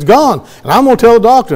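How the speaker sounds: high, loud, thrilled; excited sister. Retold or imitated. Healed sister announcing her news to her brother, thrilled. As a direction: crow it with joy.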